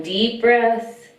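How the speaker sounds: slow, calm breath guidance; yoga breath cue. Acted. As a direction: draw out the exhale gently. Guides the breathing.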